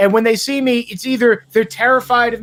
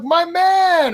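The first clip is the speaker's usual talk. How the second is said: hearty greeting back; 8 semitones higher than usual. Retold or imitated. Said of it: "I'm like".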